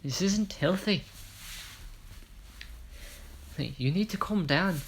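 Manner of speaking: a rough go at an Irish voice; Irish accent. Retold or imitated. Phoenix urges him to calm down, attempted Irish accent. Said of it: "I'm trying to do Irish".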